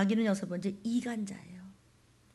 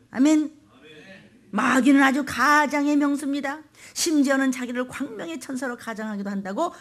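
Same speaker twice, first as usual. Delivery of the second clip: loud, raised pulpit proclamation; forceful preaching; 3 semitones higher, 9 dB louder than usual. Real, not acted. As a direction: proclaim it out to the whole congregation. (크게 선포하는 설교)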